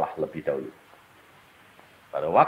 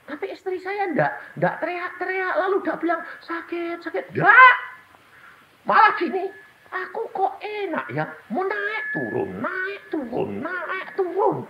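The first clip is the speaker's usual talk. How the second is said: much higher, straining sermon voice; high-pitched fervent preacher; impassioned high preaching; 10 dB louder than usual. Acted. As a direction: cry it out in wonder.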